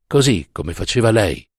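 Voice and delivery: ordinary delivery, nothing marked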